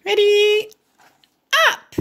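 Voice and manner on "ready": high little pig voice; Piggy getting Gerald ready, high voice. Acted. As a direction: pitch way up, eager little pig. Piggy's line.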